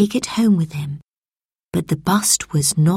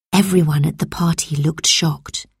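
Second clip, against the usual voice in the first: usual speaking voice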